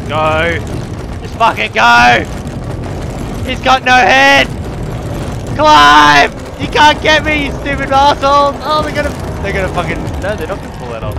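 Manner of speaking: rapid, desperate taunting shouts; frantic shouting. Real, not acted. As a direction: scream it while fleeing. Fleeing chase, yelling.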